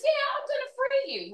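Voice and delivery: very high put-on voice; mock enslaver promise. Enslaver saying he will free her, in a high mocking voice. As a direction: toss off a high-pitched hollow promise.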